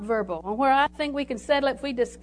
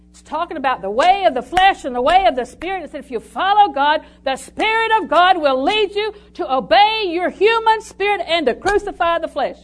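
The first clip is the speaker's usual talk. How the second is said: higher, much louder preaching at full force; 5 semitones higher, 8 dB louder than usual. Acted.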